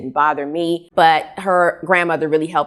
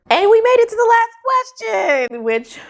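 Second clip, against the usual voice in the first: excited announcement. Real, not acted. Announcing final question of tag.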